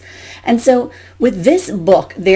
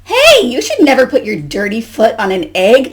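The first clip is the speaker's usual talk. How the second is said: louder storybook scolding; 3 semitones higher, 5 dB louder than usual. Acted. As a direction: tell off Goose as an indignant duck. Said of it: "Duck scolded".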